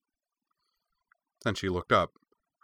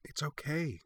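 soft reassurance; 7 semitones higher, 8 dB quieter than usual. A narrator's reading. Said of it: "for a third time".

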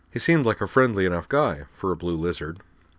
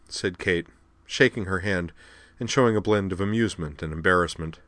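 plain reading voice

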